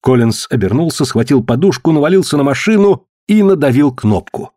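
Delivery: ordinary delivery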